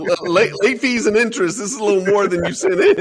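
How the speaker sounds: chuckling while quoting the joke; laughing. Retold or imitated. Laughing retelling of the hospital president's joke.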